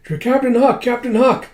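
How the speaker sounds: high-pitched acted crowd cheer; adoring cheer. Troops hailing their captain, high excited cheer. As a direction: cheer the hero's name in a high voice.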